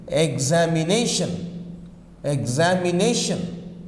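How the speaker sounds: examination said slowly, -na- stressed; slow stressed demo. Shown as a demonstration. Teacher repeating examination slowly for students, careful stress. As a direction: drill examination syllable by syllable, stress on na. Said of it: "You have the stress here".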